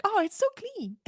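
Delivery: delighted gush. Retold or imitated. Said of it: "I'm like".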